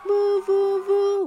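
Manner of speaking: loud, high siren whoop; hooting like a fire whistle; whistle imitation. A narrator's reading.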